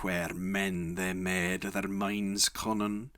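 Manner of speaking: ordinary delivery, nothing marked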